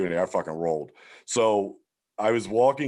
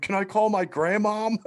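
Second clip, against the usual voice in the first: high-pitched pitiful imitation; mock a kid timidly asking a favour; pleading kid; 12 semitones higher than usual. Young prisoner asking cops for a call, mock pleading.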